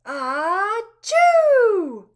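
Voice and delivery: big high cartoon sneeze; loud sneeze. A narrator's reading. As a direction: burst out a huge achoo. Hamster sneezes mid-juggle.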